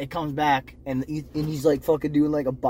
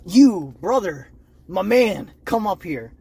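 loud, drawn-out summons; calling out; 3 semitones higher, 7 dB louder, slower than usual. Retold or imitated. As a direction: call him up to you, loud and slow. Cult leader calling someone up.

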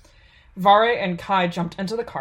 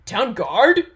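sputtered; 4 semitones higher, 7 dB louder than usual. A narrator's reading. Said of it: "the man sputtered".

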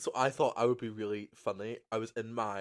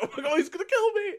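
high, loud, rushed mock panic; imitated panic; 8 dB louder, faster than usual. Imitating a friend panicking on the phone, laughing mock panic. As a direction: squeal the alarm through laughter. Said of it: "explaining his panic".

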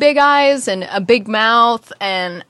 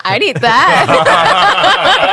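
very slow, high, laughing echo; 8 semitones higher, 6 dB louder, much slower than usual. Real, not acted. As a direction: drag the words out through laughter. Echoing the joke laughing.

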